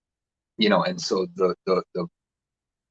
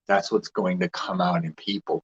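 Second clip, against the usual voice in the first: ordinary talk, nothing marked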